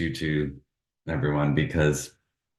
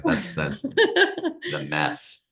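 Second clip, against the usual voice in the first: laughing; 9 semitones higher, much slower than usual. Real, not acted. Joking, laughing.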